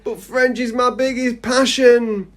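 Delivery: high, drawn-out theatrical protest; mock anguish. Pupil losing a favourite subject, mock anguish. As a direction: plead it like a melodrama victim.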